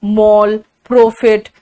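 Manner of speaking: मॉल and प्रॉफिट with over-rounded o; "mole" and "proh-fit" for मॉल, प्रॉफिट; Haryanvi pronunciation. Retold or imitated. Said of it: "उसके बाद वो कहेंगे".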